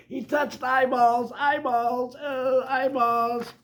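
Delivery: high, loud, repeated wail; panicked high-pitched freak-out; horrified panic. Acted. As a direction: squeal it over and over in disgust. Panicking over touched eyeballs.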